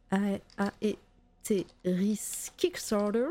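put-on slow, drawn-out American accent on Kickstarter. Streamer hamming up her American accent to chat. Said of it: "J'adore faire mon accent, euh, american".

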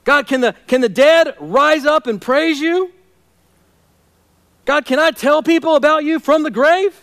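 high, loud challenging questions; cross-examining God. Acted. Worship leader questioning God about the grave, loud and challenging. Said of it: "cross-examining God with rhetorical questions".

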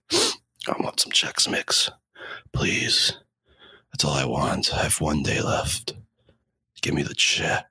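faint, breathy dying plea; dying whisper. Pretend. Dying man whispers wish.

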